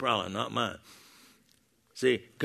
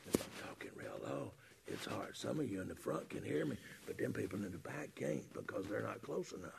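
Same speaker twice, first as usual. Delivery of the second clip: barely audible, low-pitched murmur; talking extremely low; 7 semitones lower, 22 dB quieter than usual. Shown as a demonstration. Preacher shows back rows can't hear him, barely audible. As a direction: sink to almost nothing, too soft for back rows.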